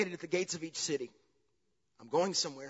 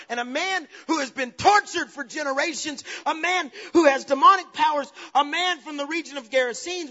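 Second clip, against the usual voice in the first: raised, loud, urgent sermon voice; full-force preaching; 9 semitones higher, 8 dB louder than usual. Acted. Preacher warns congregation of the cursed land, full-force preaching. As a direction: build to a ringing proclamation.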